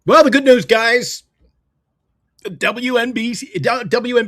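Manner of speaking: loud, high, bursting in with the good news; excited burst. Real, not acted. Co-host cuts in loudly with the good news, excited. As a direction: jump in big and bright.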